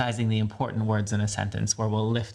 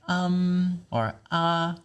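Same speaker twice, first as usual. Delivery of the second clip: "um" and "ah" drawn out and raised in pitch; lifted thinking sounds; 7 semitones higher, much slower than usual. Shown as a demonstration. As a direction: stretch "um" and "ah" on a lifted note. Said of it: "when we tend to lift our voice".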